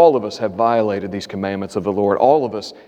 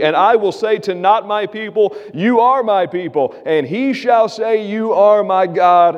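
raised, building reading of the promises; scripture reading; 6 semitones higher than usual. Acted. Pastor reading Hosea's promises to the congregation, rising and fervent. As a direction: proclaim the promises, climbing higher.

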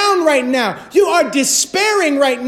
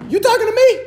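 higher, hammed-up "you talking to me?" as a joking God; mock tough-guy challenge; 9 semitones higher, much faster than usual. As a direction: play God as a movie tough guy, mocking. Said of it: "And what does God say?".

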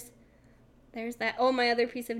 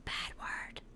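hushed, whispered scolding; 11 dB quieter than usual. Retold or imitated. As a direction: whisper it like mom in a theater. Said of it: "she would whisper it to us".

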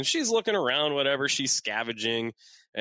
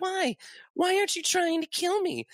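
very high-pitched questioning; put-on woman's voice. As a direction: imitate a woman, pitched far up. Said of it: "And she's like".

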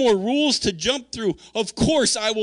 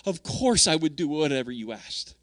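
tender yes; 5 semitones lower than usual. Retold or imitated. Repeats devoted answer to God.

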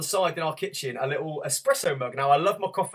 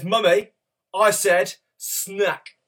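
higher, louder, very slow mock demand; toddler ultimatum; 4 semitones higher, 5 dB louder, much slower than usual. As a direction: drag out each word like a defiant child. Toddler's final demand before throwing.

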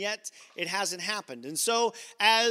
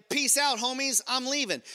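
casual sassy exit; 5 semitones higher than usual. Retold or imitated. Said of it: "Hagar's like".

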